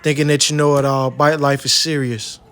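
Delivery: loud show-intro voice, punching each phrase; hype intro. Acted. Host opening his bike-life episode, loud and punchy.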